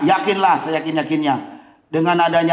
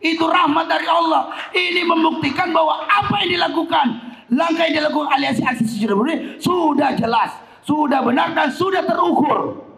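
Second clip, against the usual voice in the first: fiery rally speech; 9 semitones higher than usual. Acted.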